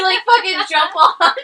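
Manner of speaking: high, loud, slow words through laughter; joking about the hotel guest while laughing. Real, not acted. Laughing at the joke.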